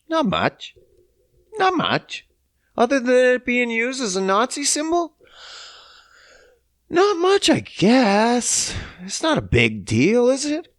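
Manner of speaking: high-pitched sarcastic downplaying; sarcastic, feigned nonchalance; mock innocence. Sarcastically downplaying the Nazi symbol.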